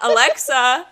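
loud call to Alexa through laughter; laughing call. Pretend. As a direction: shout the name while cracking up. Trying to wake everyone's Alexas.

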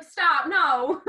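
loud, high-pitched plea to stop; mock panicked protest. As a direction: cry out in pretend alarm. Acted panicked reaction to crying.

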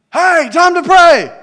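loud slow call; shouting. Retold or imitated. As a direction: shout it across the room. Calling out at potluck.

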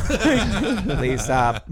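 loud laughing plea. Real, not acted. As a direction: laugh through it, high. Laughing fit at robot joke.

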